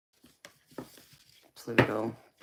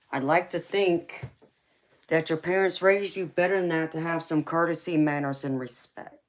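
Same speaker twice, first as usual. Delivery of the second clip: louder and higher, stressing "think" and "manners"; indignant scolding; 5 semitones higher, 5 dB louder than usual. Real, not acted. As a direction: lecture them, voice rising in indignation. Scolding rude viewer, voice rises.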